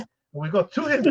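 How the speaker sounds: ordinary talk, nothing marked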